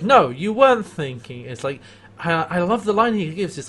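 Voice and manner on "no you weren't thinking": scolding. Retold or imitated.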